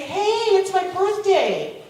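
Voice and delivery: high, loud, drawn-out hail; excited greeting. Retold or imitated. Woman spotting an old friend, excited greeting. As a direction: call out gladly to an old friend.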